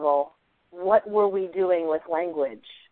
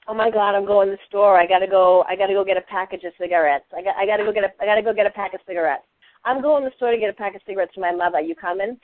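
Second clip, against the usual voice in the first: Boston accent; faster than usual. Shown as a demonstration. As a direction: put on the old Eastern Massachusetts neighborhood voice. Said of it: "I had a Boston accent".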